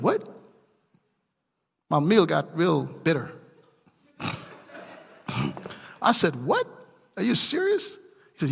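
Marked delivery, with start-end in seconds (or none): 0.0-0.3 high, incredulous "What?" rising sharply; shocked. Retold or imitated. The preacher reacting to the pastor mowing his enemy's yard, with a high, shocked "What?". 6.3-8.0 disbelief. Retold or imitated. Disbelief at the pastor's story.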